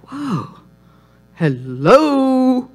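discover something eye-catching mid-flip; intrigued double take; pleasantly startled. Acted. Reacting to a surprise find in mail.

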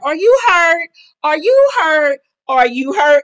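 high, loud mocking questions; mock taunting. Recapper imitating Portia goading Candace, loud mock taunt. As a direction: needle them loudly in a high voice.

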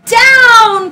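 high, loud cry of Down!; witch's cry. Acted. Witch ordering the broom to land, loud high cry. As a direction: call the broom down sharply. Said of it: "cried the witch".

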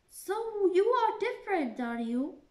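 caterpillar sizing Alice up, loud and high. Acted. Caterpillar questioning Alice, in a high loud voice.

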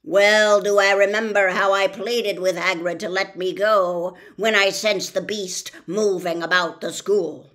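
put-on loud, otherworldly old spider voice; ancient giant spider. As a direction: boom it out like a huge ancient spider. Aragog continues speaking.

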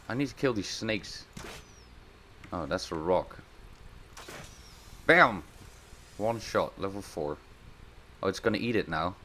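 usual speaking voice

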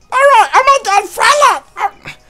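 high cartoon voice; Meatwad impression. Player voices homeboy in a high Meatwad voice. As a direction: squeal it up high.

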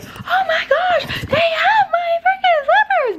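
very high, drawn-out mock squeal; excited little kid. Retelling finding slippers in Target, squealing like a kid. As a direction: imitate yourself freaking out like a kid. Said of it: "tweaking like a little ass kid".

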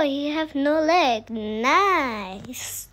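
ordinary talk

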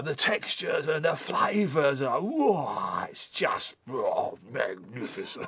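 slow, soft swooning praise; a hint of a gushing food critic; mock foodie rapture. The other fella gushing over beans on toast, slow and swooning.

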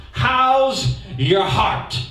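each word stretched out, How's rising; drawing out "How's Your Heart?" slowly like a headline; slow title announcement. Shown as a demonstration. Pastor announcing the sermon title, slow and stretched. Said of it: "I've entitled this talk".

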